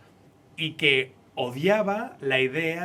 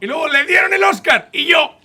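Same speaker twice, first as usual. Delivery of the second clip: much higher, loud excited cry; excited shout; 12 semitones higher, 13 dB louder than usual. Real, not acted. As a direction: shout it out in delight. Excited retelling of an award.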